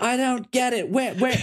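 high, lost and pleading; baffled Ethan. Retold or imitated. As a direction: squeak out the confusion. Ethan confused.

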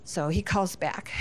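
normal talking voice

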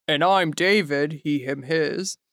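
dropped low, slow and deep. Pretend. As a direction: drop the voice way down. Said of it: "you dropping your voice".

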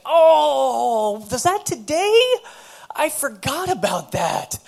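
loud, high voice of someone caught forgetting; exaggerated surprise. Woman realizing she missed her appointment, hammed-up surprise.

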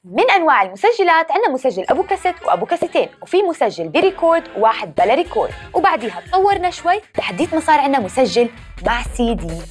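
normal talking voice

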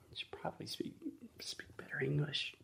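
hushed whisper. Real, not acted. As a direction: whisper it aside. Aside to listeners.

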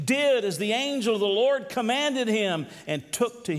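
preacher proclaiming the Bible passage; proclaimed scripture reading. Acted. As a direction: read the verses out with conviction, building. Reading scripture aloud in sermon.

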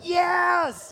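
high, loud inner yes; a triumphant silent scream let out; inner cheer. Retold or imitated. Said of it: "everything inside of me is, like, screaming".

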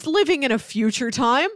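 ordinary talk, nothing marked

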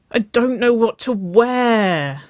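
high, loud, drawn-out whine; whining. A narrator's reading. Younger sister stuck choosing a date outfit, whining. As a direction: wail it like a helpless younger sister. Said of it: "she whined".

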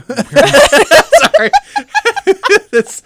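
loud, high laughing through words; real helpless laughter; laughing hard. Real, not acted. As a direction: crack up while apologizing.

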